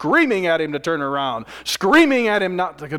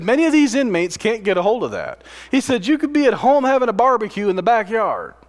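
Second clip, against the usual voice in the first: higher and louder, driving sermon climax; full-force preaching; 7 semitones higher, 5 dB louder than usual. Acted. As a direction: preach it out, building with urgency.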